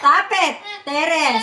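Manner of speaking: loud, high parental command; scolding. Real, not acted. Mother telling screaming Terrence to stop, scolding loudly.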